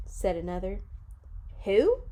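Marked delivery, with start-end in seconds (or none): 1.6-2.0 high, louder puzzled question; puzzled new kid. Acted. New kid asking about Viola Swamp, high and puzzled. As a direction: ask like a confused newcomer. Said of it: "said a kid who was new".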